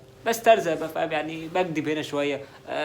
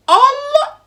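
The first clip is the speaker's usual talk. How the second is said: high, loud gasp of shock; shocked exclamation; 12 dB louder, much slower than usual. Acted. Hearing on the phone that Raymond was arrested, shocked cry. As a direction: blurt it out stunned.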